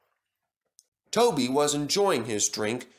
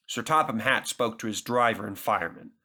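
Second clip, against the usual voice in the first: usual speaking voice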